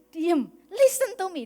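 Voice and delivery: loud, high, drawn-out demand to listen; mildly acted order to a noisy child; insistent command. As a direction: press each word out slowly and loudly.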